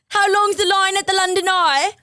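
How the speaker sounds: high, hammed-up tourist-British questions; quick silly posh British lines; mock British accent. Host teasing a British caller in mock British. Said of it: "that accent really".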